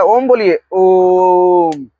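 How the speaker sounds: "ओम" held very long and slow, chant-like; slow chanted "ओम बोलिए ओम"; long drawn om. Shown as a demonstration. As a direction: hold the "ओ" of "ओम" for three beats. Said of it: "यहाँ पे ओम बोला जा रहा था".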